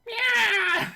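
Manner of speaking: very high, loud squeak; squeaky voice. Acted. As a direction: squeal it like a puppet. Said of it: "a squeaky voice".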